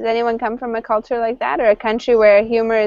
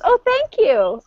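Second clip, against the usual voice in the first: much higher, earnest thank-you; naive thanks; 12 semitones higher, slower than usual. Retold or imitated. Imitating the hat wearer thanking a teasing Canadian, much higher. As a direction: play the person who takes the compliment at face value. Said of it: "and the person goes".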